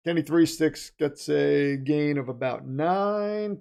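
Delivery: usual speaking voice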